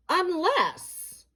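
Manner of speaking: high, solemn single word; ominous. A narrator's reading. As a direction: intone it like an omen.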